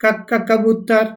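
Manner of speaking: slow क, क then कबूतर; slow phonics drill on क into कबूतर; phonics sounding. Shown as a demonstration. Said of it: "वैसे ही क का".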